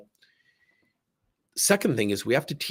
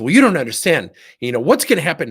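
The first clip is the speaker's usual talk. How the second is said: loud, higher, worked-up complaint; upset employee; 4 semitones higher, 9 dB louder, faster than usual. Retold or imitated. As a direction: retell the employee's agitated pushback, louder. Employees upset at leaders' snippet.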